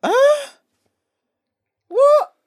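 high, loud shocked squeal; shocked disbelief. Acted. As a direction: act utterly stunned. Shocked reaction to girl leaving.

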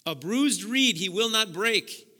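loud, ringing "A bruised reed he will not break" with a lift at the end; emphatic repeat. Shown as a demonstration. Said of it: "Think about that".

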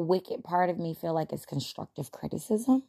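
ordinary talk, nothing marked

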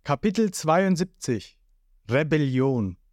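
ordinary delivery

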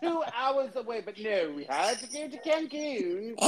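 high put-on British whine about Mallorca; a hammed-up British accent; mock British wife. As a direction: whine it like a spoiled posh tourist.

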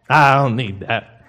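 lightly put-on, drawn-out brush-off; mock dismissal. Someone sweeping gold dust in their mansion, mock dismissive. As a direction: slowly wave it away in a mock voice.

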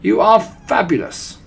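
high, loud gushing praise; adoring cry. A narrator's reading. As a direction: gush like worshipping followers. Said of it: "cried the witches".

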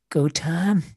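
breathy dramatic whisper. Pretend. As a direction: whisper it into the mic. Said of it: "whispering into the mic at the end".

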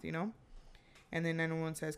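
ordinary delivery

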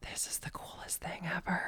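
hushed, breathless whisper of wonder; awed whisper. Retold or imitated. Hunter whispering in awe at a fox nearby, whispered. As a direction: whisper it so the fox won't hear.

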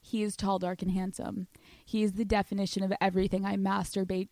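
soft, hushed tale-telling voice; storytelling voice. Acted. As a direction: draw them in for a story.

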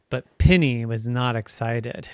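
plain reading voice

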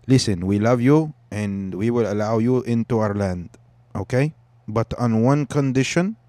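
earnest bargaining in a put-on Arabic accent. Palestinians setting a condition for newcomers, mock Arabic accent. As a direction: lay down terms with an Arabic accent. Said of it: "I'll do an Arabic accent".

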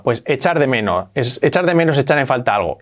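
ordinary talk

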